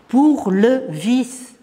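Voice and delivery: "pour le vice" drawn out word by word; slow emphatic repeat. Acted. Reciter dwelling on the poem's repeated line, slow and emphatic. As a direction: stretch "pour", "le" and "vice" slowly apart.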